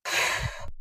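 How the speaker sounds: breathy huff of annoyance; quiet irritated exhale; exasperated sigh. A narrator's reading. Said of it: "اتنهدت بغيظ".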